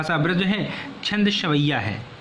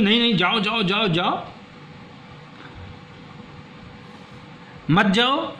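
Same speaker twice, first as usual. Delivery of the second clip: wife pleading; 3 semitones higher, 3 dB quieter than usual. Acted. Acting the wife's protest.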